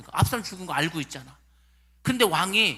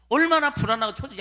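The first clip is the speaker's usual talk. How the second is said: 1 anxious emphasis; 7 semitones higher, 6 dB louder than usual. Acted. Preacher building emotion.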